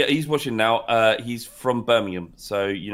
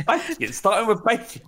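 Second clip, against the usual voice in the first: high, disbelieving repeat of baking; can't believe it's baking; incredulous surprise; 8 semitones higher than usual. Real, not acted. Incredulous echo of advice.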